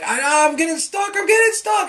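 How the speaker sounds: imitating a small son's high-pitched panicked cry; panicking child. Dad imitates son stuck in his shirt, panicking. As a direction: cry out, voice shooting way up. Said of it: "he starts to panic".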